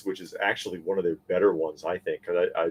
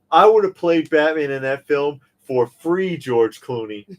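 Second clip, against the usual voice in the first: indignant; 6 semitones higher, 5 dB louder than usual. Acted. Addresses Clooney directly.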